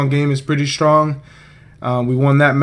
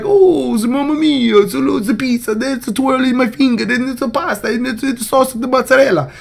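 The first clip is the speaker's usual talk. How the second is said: high, hammed-up Italian accent, much higher pitch, rattling off food words; mock Italian accent; 11 semitones higher than usual. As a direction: parody the Italian players with a cartoon Italian accent. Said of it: "I'm not being racist".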